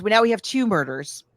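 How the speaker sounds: normal talking voice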